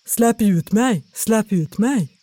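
lightly put-on hollow voice; a hint of a trapped spirit; muffled voice. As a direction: call out dully from inside a bottle. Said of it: "hörde någon med dov stämma".

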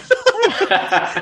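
a real, high laugh at yourself; sheepish burst of laughter; high embarrassed laugh. Real, not acted.

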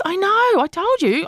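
put-on high smug teen voice; imitating daughter. Mother voicing her daughter's smug reply, high. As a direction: say it smug, like a sister. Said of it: "And she goes".

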